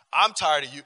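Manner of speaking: loud, weary divine rebuke; God fed up. Retold or imitated. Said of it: "God was like".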